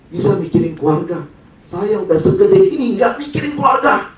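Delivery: high, loud self-reproach, drawn out; seruan sesal diri. Retold or imitated. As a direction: build to a slow, high outcry, acting out the father. Bapak menyesali diri dalam cerita. (seruan menyesali diri, makin tinggi dan lantang)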